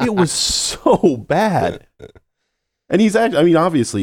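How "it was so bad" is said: slow, high, drawn-out dismay; exaggerated disgust. Real, not acted. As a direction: stretch out the words in mock horror. Ranting about overacting in film.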